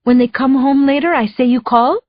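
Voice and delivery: put-on a light Spanish accent, answering the phone; lightly accented hello, picking up; Maria's accent. The housekeeper picks up, in a light Spanish accent. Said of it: "her accent".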